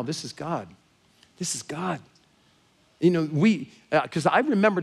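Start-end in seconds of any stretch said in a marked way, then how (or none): 0.0-2.0 softer, earnest declaration; hushed awe. Retold or imitated. Woman telling Scott and Mal who arranged this, quietly awed. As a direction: let the realization land quietly. Retold awed realization to seatmates.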